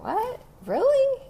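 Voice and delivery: high, squeaky mock disbelief; surprised. Bezos amazed the crabs never escape, high surprised squeak. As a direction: jump way up in pitch, astonished.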